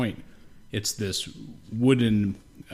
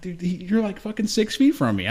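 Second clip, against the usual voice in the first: much higher, amazed and a bit nervous; high excited disbelief; 10 semitones higher than usual. Retold or imitated. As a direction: tell your buddy the deer is too close. Deer staring from close up.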